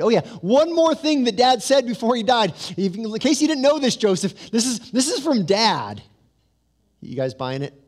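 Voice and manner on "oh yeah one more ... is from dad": high, loud sneaky sales pitch; mocking sly pitch. Preacher mocking the brothers' fake deathbed message, sly and loud. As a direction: sell the fake message with a wink.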